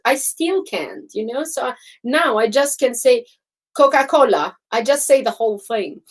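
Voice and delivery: normal talking voice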